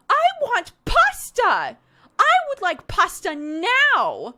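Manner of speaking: put-on high, loud, slow whiny toddler demand. Mom mimicking her toddler demanding pasta, high and loud. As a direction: shout it like a bossy two-year-old.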